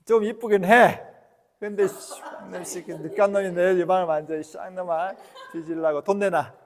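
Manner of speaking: loud, high, teasing curses; joking mock scolding. Shown as a demonstration. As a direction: tell him off with a laugh in your voice. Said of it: "이렇게 하면서 유머를 넣어도 돼".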